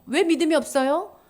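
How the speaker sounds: ordinary talk, nothing marked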